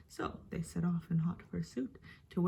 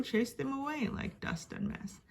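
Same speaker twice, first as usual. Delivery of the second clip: ordinary delivery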